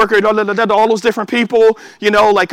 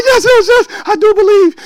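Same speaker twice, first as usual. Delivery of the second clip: eager yes; 11 semitones higher, 3 dB louder than usual. Acted. Martha finally believes, voiced.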